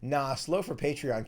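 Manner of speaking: normal talking voice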